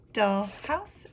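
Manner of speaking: ordinary delivery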